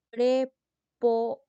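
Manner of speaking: "prepo-" broken into loud, separate syllables. Shown as a demonstration. Teacher attempting a complicated term for students, "prepo-" in separate syllables. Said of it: "tiene un nombre complicadito".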